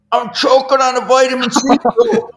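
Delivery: laughing panicked plea. Retold or imitated. Retold choking plea, laughing.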